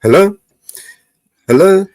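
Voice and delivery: ordinary talk, nothing marked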